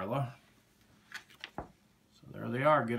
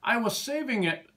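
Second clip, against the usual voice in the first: lightly voiced clucking hen, a bit higher; 6 semitones higher, 3 dB louder than usual. A narrator's reading. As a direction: cluck the words like a fussy hen. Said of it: "she clucked".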